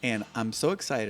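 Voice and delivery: normal talking voice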